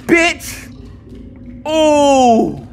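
very high, loud cheer; triumphant yell. Real, not acted. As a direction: shout in victory. Triumphant shout.

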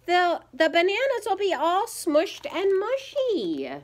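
high, whiny protest; upset child's high-pitched complaint; yelling. A narrator's reading. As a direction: wail it high at a little brother. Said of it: "yelled Ivy".

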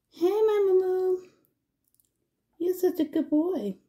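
high, loud cooing at the pet; affectionate sing-song to the pet. Real, not acted.

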